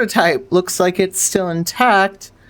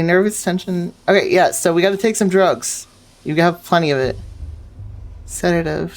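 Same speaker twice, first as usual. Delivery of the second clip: ordinary talk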